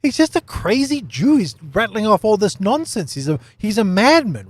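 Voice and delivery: high-pitched, exasperated scoffing; mock dismissal. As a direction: wave him off as a raving lunatic. Voicing a dismissive view of Yeshua.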